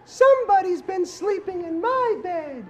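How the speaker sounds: high, loud sassy mama bear. Shown as a demonstration. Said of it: "here's your line".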